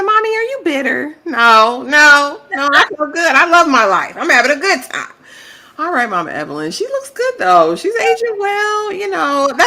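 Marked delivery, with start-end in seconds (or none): 0.0-5.1 high, bright chirpy denial; mock cheerful mother. Recapper mimicking the mother insisting she feels good, pitched up. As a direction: sing out the cheery no. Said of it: "She said".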